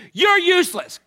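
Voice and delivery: very high, loud parting shout; all-capitals yelling. Retold or imitated. Irate caller insults supervisor before hanging up, acted yelling. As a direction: imitate a furious caller's last blast. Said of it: "Again, all capitals".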